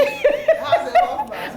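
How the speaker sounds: a sudden high squeal of laughter. Real, not acted. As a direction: shriek with amused disbelief. Laughs retelling sister's news.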